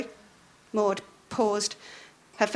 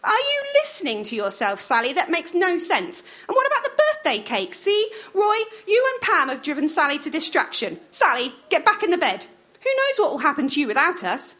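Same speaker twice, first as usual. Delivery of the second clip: bossy wife snapping at Roy; Maud scolding; 8 semitones higher than usual. A narrator's reading. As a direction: nag him to hurry up. Voicing Maud.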